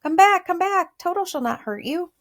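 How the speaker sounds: high, loud call to the mice; loud calling woodman. A narrator's reading. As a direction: call them back across the grass. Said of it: "he called to the mice".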